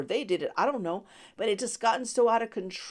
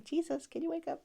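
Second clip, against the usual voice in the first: mock calm ask; 11 semitones higher, 6 dB quieter than usual. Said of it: "I don't think they calmly".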